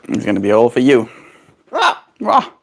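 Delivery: ordinary talk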